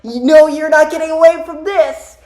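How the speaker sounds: high, loud strained hero voice; hammed-up cartoon determination; Silver. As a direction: shout after an escaping enemy. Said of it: "but Silver is like".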